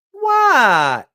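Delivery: hammed-up teacher imitation, high and loud; mock effeminate teacher. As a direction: camp it up as the teacher's shocked reply. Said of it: "Just like that".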